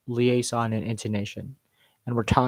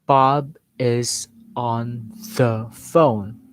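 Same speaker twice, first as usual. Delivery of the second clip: very slow, each word set apart; over-careful, drawn-out word-by-word delivery; much slower than usual. Shown as a demonstration. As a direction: pronounce every single word separately, with clear gaps. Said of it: "do not speak word by word".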